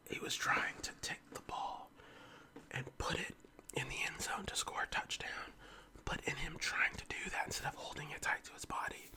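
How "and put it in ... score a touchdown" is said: ordinary talk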